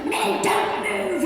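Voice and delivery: high, slow mock command from mom; hammed-up strict mother, drawn out; imitating her mom scolding, high and slow; mom yelling. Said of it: "she's like".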